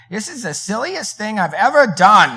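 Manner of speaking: loud, high-pitched mock exasperation; cranky. Tired actress grumbles to her director on a long shoot, cranky. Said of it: "getting tired and getting cranky".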